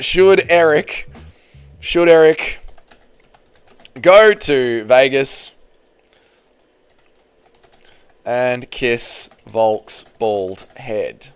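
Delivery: slow, drawn-out ceremonial question; dramatic slow question. Acted. Streamer asking the Magic Eight Ball slowly for suspense. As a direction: stretch each phrase for suspense. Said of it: "asking the Magic Eight Ball".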